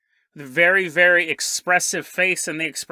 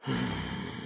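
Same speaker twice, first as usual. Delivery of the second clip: low quiet sigh; sad sigh; 9 semitones lower, 12 dB quieter than usual. Acted. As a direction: sigh heavily in sorrow. Bigfoot shakes his head sadly.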